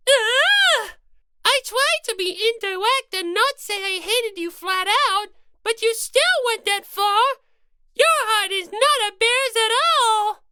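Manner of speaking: high-pitched indignant rabbit mascot; Monomi voice. Acted. Monomi upset.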